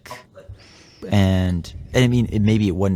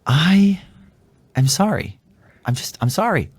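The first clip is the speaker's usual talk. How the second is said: higher, pleading apology; earnest apology to wife, raised and pleading; heartfelt apology; 6 semitones higher than usual. Retold or imitated. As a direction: pour out a sincere sorry, voice lifted. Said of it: "and I say".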